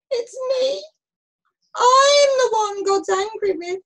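high wail, swelling loud; groaning confession. A narrator's reading. Jonah confessing to the sailors, groaning wail. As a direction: own up in a miserable wail. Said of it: "And he groaned".

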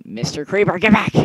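loud, high yell at a creeper; shouting. Real, not acted. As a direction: yell get back. Yelling at creeper.

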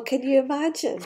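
usual speaking voice

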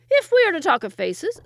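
high, very loud comeback; angry retort. A narrator's reading. Second wazir answering his rival's insult, loud and angry. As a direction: pounce on the insult loudly.

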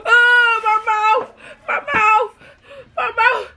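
high wailing cries of pain; pained crying. Pretend. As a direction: wail about a burning mouth. Fake pain from hot candy.

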